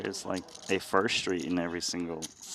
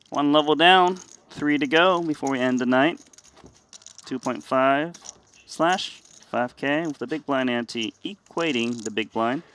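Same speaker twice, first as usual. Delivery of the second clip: louder, slower announcer delivery; announcer read; 5 semitones higher, 4 dB louder than usual. Acted. Announces the level on air.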